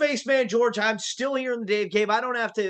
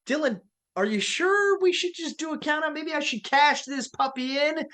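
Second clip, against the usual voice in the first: high; 7 semitones higher than usual. Acted. Referee mock-tempted by briefcase.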